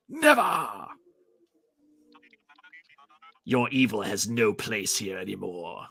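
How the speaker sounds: a hint of a heroic beast; lightly voiced defiant hero. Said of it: "I don't know if that's how Beast sounds".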